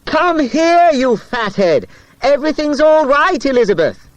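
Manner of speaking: high, loud shout across the field. A narrator's reading. Boy shouting after the rider across a field, loud shout. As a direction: yell it at the top of your voice. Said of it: "shouting at the top of his voice".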